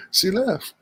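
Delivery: high, soft amused disbelief; amused surprise. Real, not acted. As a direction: say it half laughing, surprised. Guest drops off stream.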